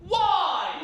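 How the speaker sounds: ordinary talk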